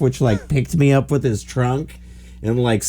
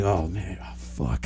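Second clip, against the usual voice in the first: whispered oh fuck, much softer; hushed panic; 6 semitones lower, 12 dB quieter, slower than usual. Retold or imitated. Zookeeper seeing dead giraffe, hushed panicked whisper. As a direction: mutter the curse under breath.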